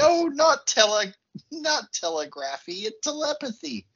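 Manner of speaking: "telepathy" pitched high against "telegraphy"; mock correcting. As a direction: correct them, lifting "telepathy" well up. Acts out correcting the telegram lady.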